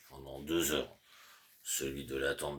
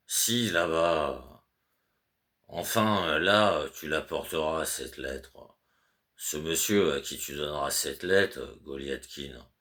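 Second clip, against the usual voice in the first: louder, insistent instructions to a servant; fussy master's orders; 4 dB louder than usual. Acted. Master Goliatkin instructing his servant Petrouchka, louder and insistent. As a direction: spell it out to a sleepy servant.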